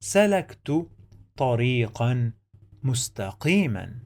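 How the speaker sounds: each word said apart with pauses. Shown as a demonstration. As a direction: break the sentence into separate words. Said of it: "I'm gonna read and write".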